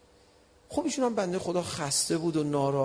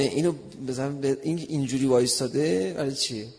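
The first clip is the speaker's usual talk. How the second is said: ordinary delivery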